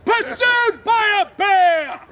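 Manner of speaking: high, drawn-out shout; dramatic announcement. Acted. Narrator sending actors off chased, slow dramatic shout. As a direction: bellow it slowly like a grand stage alarm.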